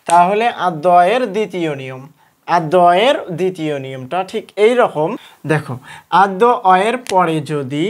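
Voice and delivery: ordinary talk, nothing marked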